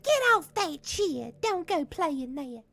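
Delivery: scolding teacher, loud and high; strict teacher. Retold or imitated. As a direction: yell at the kids like a teacher. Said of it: "the teachers would be like".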